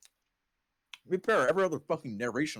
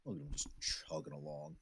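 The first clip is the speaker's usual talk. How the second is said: soft unvoiced aww at a dog; fond whisper; 8 semitones lower, 16 dB quieter than usual. Real, not acted. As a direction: coo over him quietly.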